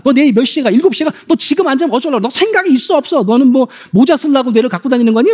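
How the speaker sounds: nagging parent. Acted. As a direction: pile on the scolding like a fed-up mom. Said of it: "머리 높이 소통은 이런 거예요".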